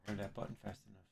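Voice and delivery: ordinary delivery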